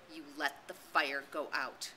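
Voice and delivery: slow, low cold reproach; cold accusation. A narrator's reading. Ralph accusing Jack, slow cold reproach. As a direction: say it flat and accusing.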